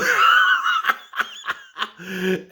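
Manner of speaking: big high hearty laugh; loud laugh. Real, not acted. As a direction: let out a loud belly laugh. Big laugh after the line.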